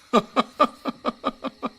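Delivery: a bright, high-pitched giggle. Acted. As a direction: giggle with delight at the joke. Laughing at the news.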